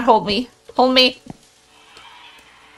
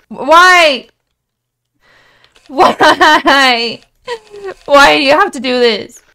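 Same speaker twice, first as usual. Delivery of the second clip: 1 loud whining; 3 semitones higher, 8 dB louder, slower than usual. Real, not acted. Complaining to the game.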